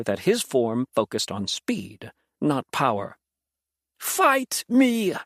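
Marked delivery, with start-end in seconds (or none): 4.0-5.1 straining against the vines, demanding a fight; challenge. A narrator's reading. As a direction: hurl the challenge at him. Bound demon challenging Noah.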